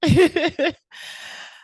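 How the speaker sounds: loud, high burst of laughter; hearty laugh. Real, not acted. Host tickled by the grandmother airplane story, laughing.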